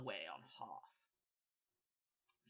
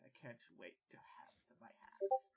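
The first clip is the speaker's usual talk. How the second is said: menacing whisper; 5 semitones higher, 4 dB quieter than usual. Acted. Emperor voiced by game master.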